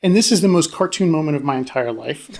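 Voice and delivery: normal talking voice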